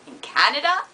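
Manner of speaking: hammed-up Canadian with rising endings; lilting mock Canadian accent. Shown as a demonstration. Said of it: "I also know how to say Tim Hortons".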